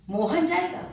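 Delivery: जाएगा lifted high; surprised tone. Shown as a demonstration. Teacher contrasting three tones of one sentence, surprised. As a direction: sound amazed at the news. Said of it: "तीनों बार हम जब अलग-अलग शब्दों पर".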